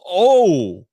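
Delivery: a high, loud startled "Oh."; sudden realization. Retold or imitated. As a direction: react as the twist hits you. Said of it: "and you're like".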